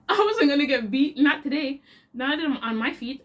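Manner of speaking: real laughter spilling into the words; laughing defiance. Real, not acted. Storyteller boasting she escaped the beating, laughing.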